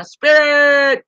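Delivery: 갑자기 크게 외치는 "Spirit!"; sudden shout. Real, not acted. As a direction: shout the name out high and loud. Shouting out her own show name.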